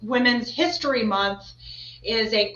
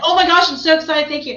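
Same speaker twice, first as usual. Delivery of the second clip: loud, high, gushing fake thrill; pretend excitement; 7 semitones higher, 9 dB louder than usual. Host showing co-host how to pretend to love it, mock excited. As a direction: overplay being thrilled, pitched up and loud. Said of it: "Just pretend like you love".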